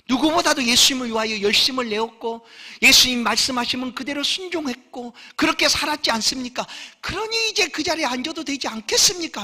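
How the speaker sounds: high, loud, self-righteous demand; disciple demanding reward. Acted. James and John press Jesus for their reward, high and insistent. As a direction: list your merits and demand the seat.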